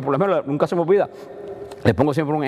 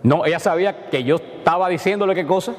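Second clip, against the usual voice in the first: usual speaking voice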